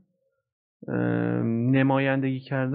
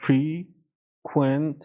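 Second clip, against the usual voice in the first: slowed down; much slower than usual. Shown as a demonstration.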